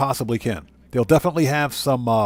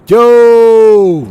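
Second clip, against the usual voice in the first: hype shout; 5 semitones higher, 11 dB louder than usual. Real, not acted. Hyping gifted memberships to chat.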